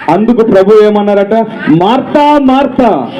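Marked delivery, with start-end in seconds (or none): 1.7-3.1 high-pitched calling out, as if reprimanding; scolding call. Acted. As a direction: call the name sharply in a high, raised pitch. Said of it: "గట్టిగా పిలిచారా లేదా?". (గద్దిస్తూ హెచ్చు స్వరంతో పిలుపు)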